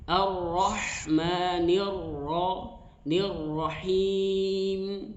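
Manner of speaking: Ar-Rahmanir Rahim said slowly, the ha flowing; slow tajwid recitation. Shown as a demonstration. Quran teacher modelling the verse for students, slow and flowing. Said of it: "perlu dialirkan".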